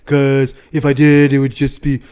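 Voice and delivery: weird, loud impression of a boastful politician; Donald Trump. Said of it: "in a weird Trump voice".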